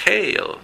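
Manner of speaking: calling out Hail as a pronunciation model; emphatic pronunciation model. Shown as a demonstration. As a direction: Hail called out high and loud. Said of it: "Number ten es cuando cae granizo".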